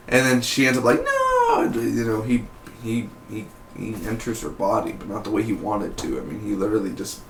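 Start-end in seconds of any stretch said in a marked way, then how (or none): 1.0-1.7 mock high cry. Whitney's victim cries out.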